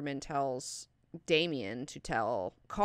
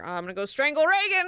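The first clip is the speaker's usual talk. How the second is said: high, loud, rushed outburst; imitated frantic resolve; mock demonic urge; 10 semitones higher, 6 dB louder, much faster than usual. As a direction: blurt it like an over-eager killer. Acting the demon's eager urge.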